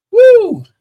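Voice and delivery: high ecstatic whoop; a sudden burst of joy; ecstatic woo. Real, not acted. Ecstatic cry mid-sermon.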